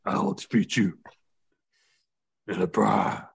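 a hint of a ranting wrestler; mock wrestler threat. Terry Funk taunting the Undertaker in a hammy voice.

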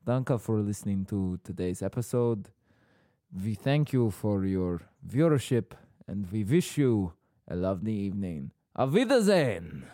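light mock German sign-off; lightly German-accented farewell. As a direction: say goodbye in a hint of German. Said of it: "going a bit weird there".

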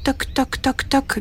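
soft, tapped knocking; imitated heartbeat. Narrator imitates Étincelle's heartbeat, soft knocking. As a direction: tap it lightly like a pulse.

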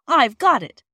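a loud, high shout of "Hooray!"; sudden cry. A narrator's reading. Peter to his sisters in the hayloft, a loud joyful cry. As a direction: burst out with it as the idea strikes. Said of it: "cried Peter suddenly".

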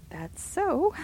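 high, nervously casual; scared, forced calm. A narrator's reading. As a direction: fake calm over fear. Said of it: "in a scared voice which she tried to make natural".

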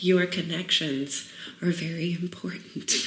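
soft whispered self-talk, slowed; quiet slow inner reminder. Pretend. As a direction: murmur it to yourself like a note. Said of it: "I have to remind myself".

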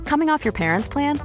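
ordinary delivery

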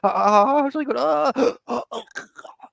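high, loud giggling protest; squealing and laughing while tickled; being tickled. Retold or imitated. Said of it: "qu'est-ce qui se passe quand on rigole".

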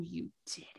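whispered sassy jab. Pretend. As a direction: hiss it under your breath.

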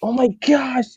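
high-pitched mock gushing over an accent; excited classmates imitated. Mimicking classmates who ask where they're from, high and excited. Acting out curious new classmates.

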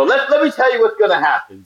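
high, eager briefing voice; producer voice. Retold or imitated. Crew guy briefing actors on the raft, high and eager. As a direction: pitch it up like an excited crew guy laying out the plan. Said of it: "They go".

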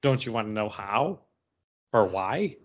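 ordinary talk, nothing marked

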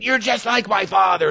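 high strained yell at moderate volume; mock scream. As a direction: imitate her screaming accusation up high. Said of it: "she eventually would be screaming".

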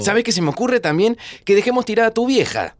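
sarcasmo furioso, cada vez más agudo; enojado. Acted. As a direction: se lo echa en cara, indignado. Discusión amarga con el amigo enfermo. (reproche furioso y sarcástico)

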